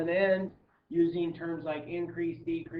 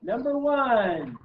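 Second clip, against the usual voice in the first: big slow announcer call, higher and louder; game show host voice; 5 semitones higher, 6 dB louder, much slower than usual. Shown as a demonstration. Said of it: "That's my game show host voice".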